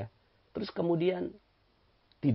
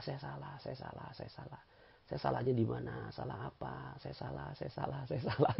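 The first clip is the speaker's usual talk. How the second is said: softer and fading, repeated admission; humble admission; 10 dB quieter than usual. Shown as a demonstration. Preacher showing the congregation how to admit fault, softer and fading. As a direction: murmur it humbly, trailing away.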